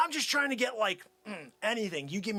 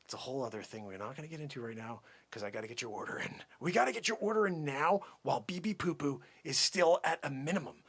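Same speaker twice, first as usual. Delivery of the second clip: ordinary delivery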